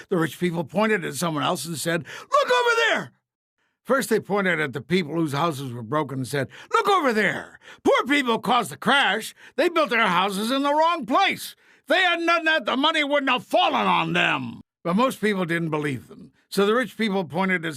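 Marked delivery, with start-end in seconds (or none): 2.2-3.1 much higher, loud shout; hammed-up shout. A narrator's reading. Rich people pointing elsewhere. 6.7-14.6 higher, louder accusing rant; blaming shout. A narrator's reading. Rich blaming the poor.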